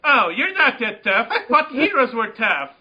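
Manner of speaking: put-on high-pitched taunting game kid; annoying kid. Kid interrupts player listening to a quest, taunting. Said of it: "some fucking kid comes".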